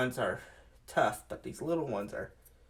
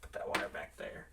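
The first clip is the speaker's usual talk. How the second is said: whispering; 13 semitones lower, 10 dB quieter than usual. Real, not acted. Careful wiring work.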